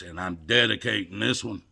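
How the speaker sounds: ordinary talk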